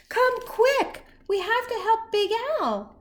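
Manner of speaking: high, urgent plea; rally the other fish to help; high urgent call for help. Acted. Shrimpy calling other fish.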